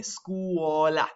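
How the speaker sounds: "Scuola" as skoo-OH-la, the U sounded; U pronounced. Shown as a demonstration. Teacher isolating the word for students, clear U. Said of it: "I'm pronouncing the U in there".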